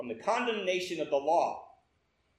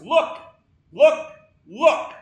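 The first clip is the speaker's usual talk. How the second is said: high, loud, punched single words; shouted exhortation; 7 semitones higher, 11 dB louder, much slower than usual. Acted. Preacher to young man in the pews, shouting. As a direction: fire each word out as a preacher's cry. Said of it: "he shouted at the top of his voice".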